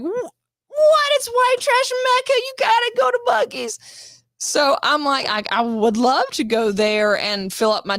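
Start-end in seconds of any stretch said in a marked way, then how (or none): 0.0-4.2 high, excited voice pushing me to Buc-ee's; excited friends. Retold or imitated. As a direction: squeal it like a fan. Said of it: "everybody's like".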